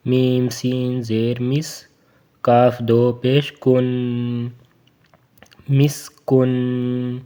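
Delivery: slow, drawn-out مسکن; slow tajweed drill; spelling drill. Shown as a demonstration. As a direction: sound out each syllable slowly. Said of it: "spelling drill".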